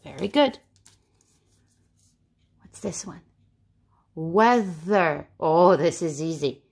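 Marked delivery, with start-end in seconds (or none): 4.1-5.3 WEATHER stretched, with a buzzing th; voiced TH. Shown as a demonstration. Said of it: "Weather makes the th".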